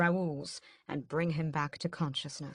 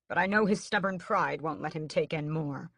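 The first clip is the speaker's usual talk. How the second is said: ordinary delivery, nothing marked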